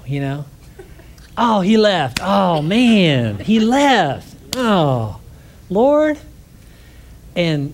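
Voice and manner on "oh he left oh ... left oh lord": loud, high "oh man, he left" in dismay; dismayed exclaim. Retold or imitated. Preacher retelling his dismay at a missed chance, loud and high. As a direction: cry out in mock despair at the missed chance.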